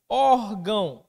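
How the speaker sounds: "Órgão" loud on a long Ór; first-syllable stress. Shown as a demonstration. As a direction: hit and hold the Ór in "Órgão". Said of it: "Paroxítona terminada em ão". ("Órgão" com o Ór forte e alongado)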